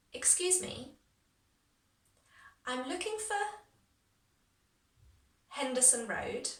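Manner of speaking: chunk by chunk with long pauses; broken down. Shown as a demonstration. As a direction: leave room to repeat after each chunk. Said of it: "I'll break it down for you".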